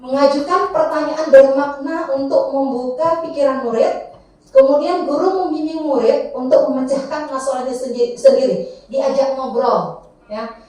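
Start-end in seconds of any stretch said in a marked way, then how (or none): none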